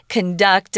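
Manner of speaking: "conduct" said con-DUCT; short weak first syllable in "conduct", weight on "duct"; stress on second syllable. Shown as a demonstration. Teacher listing verb examples, second-syllable stress on "conduct". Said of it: "which we usually use as a verb".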